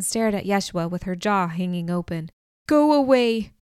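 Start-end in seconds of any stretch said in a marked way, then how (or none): 2.7-3.5 loud, raised cry to drive someone off; fierce deep-voiced shout. A narrator's reading. Stone orders Yeshua away, in a fierce raised shout. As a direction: shout it forcefully, pitched up from the narration. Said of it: "Her voice was deeper than I'd known possible".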